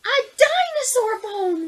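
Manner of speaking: high, loud, slow thrilled cry; girl crying out over her find; cried out. Acted. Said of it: "Rosalind cried".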